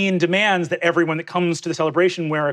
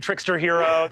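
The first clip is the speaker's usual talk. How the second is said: usual speaking voice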